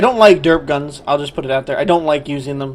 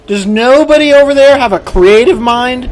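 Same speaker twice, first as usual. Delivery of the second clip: higher and louder, fed up and furious; angry outburst; 6 semitones higher, 5 dB louder than usual. Real, not acted. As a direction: yell the question at the developers in disbelief. Ranting at the game developers.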